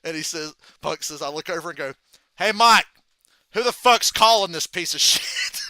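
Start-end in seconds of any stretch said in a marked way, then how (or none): 2.4-5.6 raised, much louder mock call to the referee; retold shout for all to hear. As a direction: holler it across the ring, imitating a wrestler. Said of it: "Punk just says out loud".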